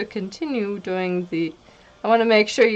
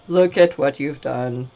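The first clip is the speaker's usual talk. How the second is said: ordinary delivery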